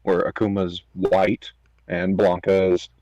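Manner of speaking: ordinary talk, nothing marked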